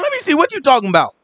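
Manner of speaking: high, mock-baffled demand; incredulous. Teacher acting a listener demanding an explanation, incredulous. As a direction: pitch it up in acted disbelief.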